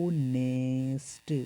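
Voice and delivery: "ऑनेस्ट" drawn out very slowly, starting on the vowel "ओ" with no h; teacher slowly spells out the sound of honest; silent h demo. Shown as a demonstration. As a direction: stretch out "ऑनेस्ट", opening straight on the vowel. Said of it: "क्या बोल रहा हूँ?".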